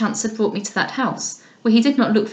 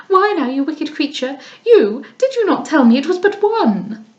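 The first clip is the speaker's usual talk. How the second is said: put-on affected young lady; 5 semitones higher than usual. Lady Louisa complains to Mrs Beaumont about the dust, affectedly high. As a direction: whine about the dust like a pampered girl.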